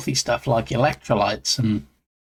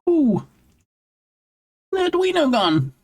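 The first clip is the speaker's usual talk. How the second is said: dismayed cry; 14 semitones higher, slower than usual. Retold or imitated. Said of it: "I'm like".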